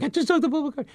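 very high, giddy squeak; hyperventilating excitement. Retold or imitated. Calling his wife from the bathroom, hyperventilating high squeal. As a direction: gush it in a breathless panic of joy. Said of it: "I start hyperventilating".

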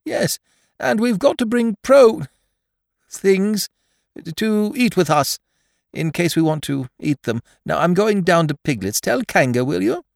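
high-pitched bear, halting over his message; high, earnest storybook bear; Pooh, busy messenger. Acted.

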